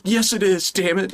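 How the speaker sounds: hammed-up exasperated comeback; indignant retort. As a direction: insist in high-pitched mock outrage. Acted retort defending sweet tea.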